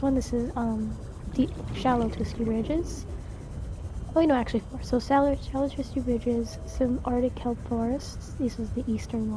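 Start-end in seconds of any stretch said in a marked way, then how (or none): none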